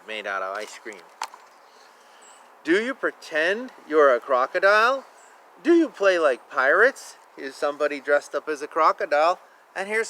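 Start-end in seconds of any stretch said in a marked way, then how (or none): none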